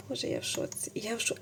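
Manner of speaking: soft unvoiced whisper; awed whisper. Real, not acted. Vlogger overwhelmed by gift needles, whispering. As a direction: barely breathe the words.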